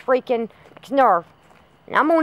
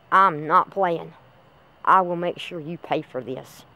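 low threat; 7 semitones lower, 5 dB quieter, slower than usual. Acted. Threatening the missing girl.

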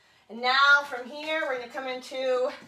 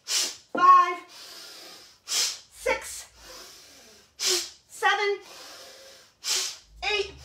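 rapid nasal breath pumps; 7 semitones higher, 3 dB louder, much slower than usual. Shown as a demonstration. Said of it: "It's called breath of fire".

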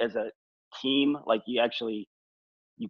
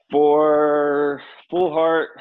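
drawn out; 5 semitones higher, 6 dB louder, much slower than usual. Real, not acted. Drawn-out name.

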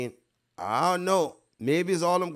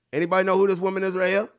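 calling out; 6 semitones higher, 6 dB louder, much faster than usual. Real, not acted. Calling out to his viewers.

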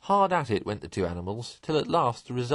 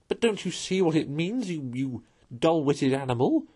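high-pitched exasperation; higher, pressing and fed up; impatient cry; 6 semitones higher than usual. A narrator's reading. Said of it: "cried the rat impatiently".

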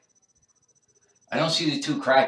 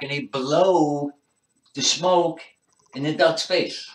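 usual speaking voice

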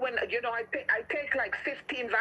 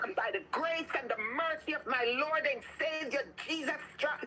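commanding healing prayer; 4 semitones higher than usual. Real, not acted. Preacher commanding sickness out of listeners, fervent prayer.

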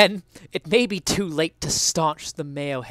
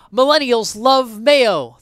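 higher, louder, slow headline; headline scream; 7 semitones higher, 10 dB louder, slower than usual. Acted. Essayist reads a thrilling headline aloud, slow and loud. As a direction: trumpet it like a headline. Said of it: "the headline screamed".

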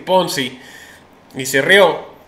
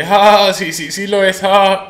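awkward reply; 4 semitones higher, 6 dB louder, slower than usual. Retold or imitated. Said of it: "lo sentí así como incómoda o torpe".

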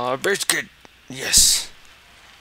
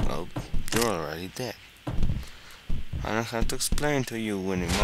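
ordinary delivery

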